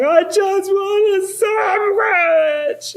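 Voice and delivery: high, slow, loud sobbing wail; wailing child. Retold or imitated. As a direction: blubber it like a hungry little girl. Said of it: "She's like".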